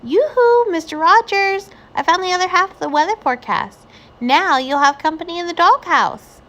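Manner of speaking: high, loud hallooing call. A narrator's reading. Amelia Bedelia calling Mister Rogers from the door, loud. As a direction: call through the house playfully. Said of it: "opened the door and called out".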